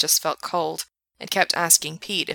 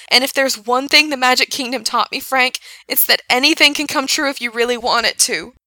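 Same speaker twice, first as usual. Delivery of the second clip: passionate yelling; 6 semitones higher, 7 dB louder than usual. A narrator's reading. Gerard pleading, yelling on.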